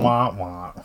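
low "wah, wah" of comic failure; mock sad trombone. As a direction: imitate a deflated trombone. Mock letdown after hearing none.

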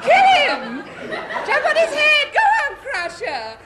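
loud bloodthirsty ringside yells; cheering shout. Acted. Fan yelling at the wrestler from her ringside seat, loud cheering. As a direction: egg the wrestler on at full voice.

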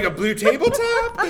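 laughing. Retold or imitated. Teasing quote, laughing.

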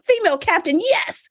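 high, thrilled little cheer; excited cheer. Retold or imitated. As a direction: cheer it like a delighted teen. Teen self cheering female captain.

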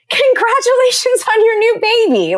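high, gushing good wishes; bubbly, high-pitched cheer; congratulatory. Shown as a demonstration. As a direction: model a delighted congratulations. Models nicer thing to say.

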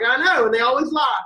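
knowing high retort. Retold or imitated. Lee knowingly correcting Billy.

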